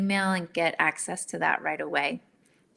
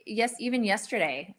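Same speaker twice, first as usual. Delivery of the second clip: ordinary talk, nothing marked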